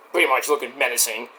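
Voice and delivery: ordinary delivery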